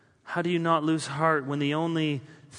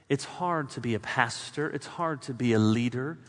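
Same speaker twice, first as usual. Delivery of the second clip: usual speaking voice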